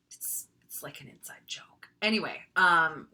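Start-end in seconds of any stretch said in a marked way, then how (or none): 0.1-1.9 soft whispered aside; unvoiced private whisper. Real, not acted. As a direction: whisper the joke to the class. Aside about inside joke.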